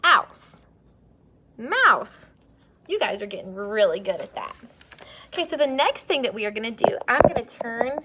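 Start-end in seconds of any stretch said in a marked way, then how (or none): 0.0-2.3 "Mouse" said high and loud; excited blend. Shown as a demonstration. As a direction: punch "Ouse" and "Mouse" up.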